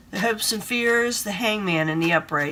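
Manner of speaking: usual speaking voice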